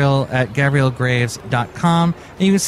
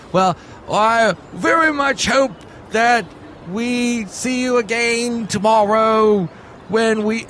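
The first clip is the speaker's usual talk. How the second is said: slow, higher put-on British accent; 6 semitones higher, slower than usual. Shown as a demonstration. Host obliging the sidekick's request, put-on British accent. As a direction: draw it out in a mock British accent. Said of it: "say that all with your British accent".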